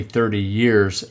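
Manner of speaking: ordinary delivery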